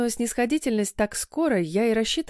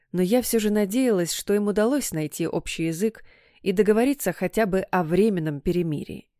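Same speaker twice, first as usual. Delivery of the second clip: usual speaking voice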